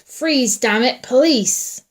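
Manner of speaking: loud, high, drawn-out police shout. A narrator's reading. Policeman challenging attackers in the dark, shouted. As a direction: order them to halt at full voice. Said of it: "Wyatt shouted".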